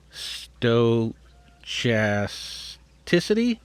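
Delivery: "stochasticity" sounded out very slowly, with ch as in chat; slow, questioning "stochasticity" with a ch sound; unsure pronunciation. Real, not acted. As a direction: drag out "stochasticity" haltingly as sto-CHAS-ticity, ending on a question. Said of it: "I don't know how to pronounce it".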